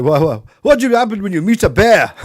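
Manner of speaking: a light put-on Slovakian accent; imitating a Slovakian friend. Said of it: "he's Slovakian and talks a bit like that".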